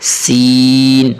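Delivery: long chanted سین; drawn-out seen. Shown as a demonstration. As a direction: stretch the سین out slowly. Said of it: "تھوڑا سا سین کو کھینچیں گے".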